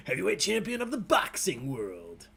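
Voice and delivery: hammed-up champion proclamation, laughing into it; grand announcer voice. Host mocks own clumsy question wording, hammed-up announcer voice. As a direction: put on a mock-epic voice. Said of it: "You sound like, uh, Will Arnett as Batman".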